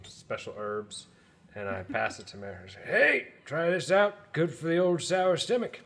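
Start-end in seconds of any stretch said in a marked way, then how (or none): 2.8-5.8 high, drawn-out character voice; louder, singled-out character line, unhurried; in-character friendly offer. Acted. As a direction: pitch it high and slow as you offer a remedy. Player voices character offering pipe.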